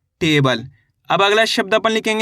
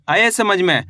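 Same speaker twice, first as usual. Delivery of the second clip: ordinary delivery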